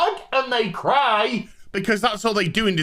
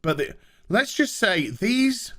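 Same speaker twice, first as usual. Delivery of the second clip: ordinary talk, nothing marked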